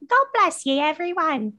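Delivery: put-on high squeaky child's blessing; sweet mock little boy; Tiny Tim. Cohost voices Tiny Tim in a high child's voice. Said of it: "And the kid's like".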